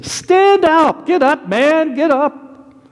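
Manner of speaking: high-pitched rousing call, slowing toward the end; excited urging. Acted. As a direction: urge someone up with great news. Crowd urging blind man to rise.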